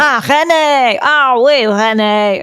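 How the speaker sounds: put-on British sitcom French accent, over the top. Mocking Allo Allo, exaggerated French accent. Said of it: "doing it with the French accent".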